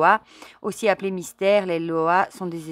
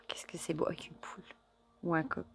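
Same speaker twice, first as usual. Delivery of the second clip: quick, hushed whisper of wonder; awed whisper; 12 dB quieter, faster than usual. Real, not acted. Marvelling at the Grande Brigitte card, in a quick whisper.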